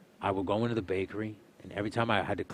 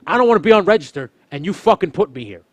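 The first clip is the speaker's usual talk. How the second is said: higher and louder furious rant; higher, louder heated mock yelling; angry retort; 9 semitones higher, 14 dB louder than usual. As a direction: tell off the boss who put you there. Retold angry outburst at work.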